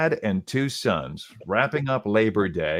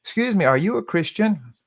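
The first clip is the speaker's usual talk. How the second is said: higher-pitched imitation of a little boy; high little-boy question, retold; little boy asking; 9 semitones higher than usual. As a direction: ask it as a small boy would, pitched up. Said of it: "the little boy asked him".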